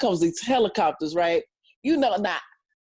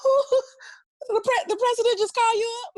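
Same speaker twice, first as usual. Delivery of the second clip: much higher, breathless with laughter; stunned disbelief; 13 semitones higher than usual. Retold or imitated. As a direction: squeal like you can't believe who's calling. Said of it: "I'm like, click".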